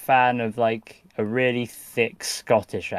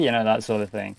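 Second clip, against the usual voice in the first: ordinary delivery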